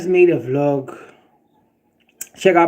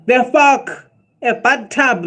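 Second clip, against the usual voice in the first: offended disbelief; 6 semitones higher, slower than usual. Real, not acted. Offended by commenter.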